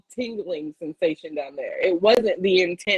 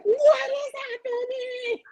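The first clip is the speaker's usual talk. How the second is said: panicked cry; 14 semitones higher, faster than usual. Retold or imitated. Retelling her panic as her lips burned, high frantic cry. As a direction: shriek it in panic. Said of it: "And I'm just like".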